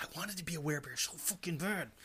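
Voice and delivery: soft, whispered confession; hushed longing. Real, not acted. Host confessing a Skyrim wish, hushed and whispered.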